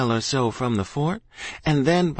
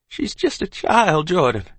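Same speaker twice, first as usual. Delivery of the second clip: pleading mother; 6 semitones higher, 3 dB louder than usual. A narrator's reading. Mother pleads with husband, distressed. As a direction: plead high for the child.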